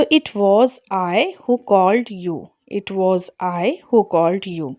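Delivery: very slow, spaced-out It was I; stress on I. Shown as a demonstration. Teacher slowly modelling the cleft sentence. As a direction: spell it out slowly, leaning on I. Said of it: "subject को जोर देकर बोल रहे हैं".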